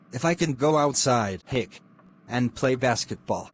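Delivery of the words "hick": hiccup. Acted. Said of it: "I think you have the hiccups".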